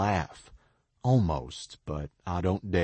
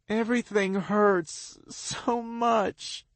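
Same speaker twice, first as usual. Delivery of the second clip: high, slow, tearful; crying in pain; 13 semitones higher, slower than usual. A narrator's reading. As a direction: let the pain break through. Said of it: "she cries".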